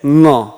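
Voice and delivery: ङ drawn out slowly as a back nasal "ṅa"; correct ङ. Shown as a demonstration. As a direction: hold ङ slowly, velar "ṅa", not ड or ण. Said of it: "ये ड या ण है ही नहीं". (धीमे से कंठ्य ङ का उच्चारण)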